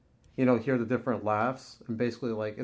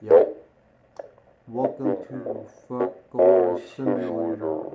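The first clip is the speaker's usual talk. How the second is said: slow, low voice changer; filtered mask voice, slow; 4 semitones lower, 3 dB louder than usual. Shown as a demonstration. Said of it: "my voice with the first pitch".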